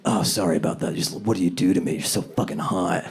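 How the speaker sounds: low, soft mock apology; lightly acted smooth-talking excuse; quietly flattering, half-apologetic; sheepish excuse. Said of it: "You can be like".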